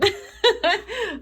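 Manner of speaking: real high giggle; amused high laugh. Real, not acted. Still laughing.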